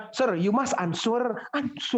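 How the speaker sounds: high "answer" as "an-soor"; mock student sounding the W in "answer"; awkward mispronunciation. Student addressing Sir, awkward "an-soor" for answer. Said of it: "nggak enak".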